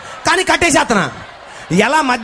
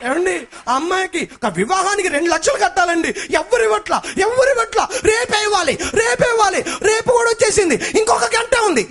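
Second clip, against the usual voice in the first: panicked plea; 8 semitones higher than usual. Retold or imitated. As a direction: shout it in a rising panic. Panicked wife pleading about money.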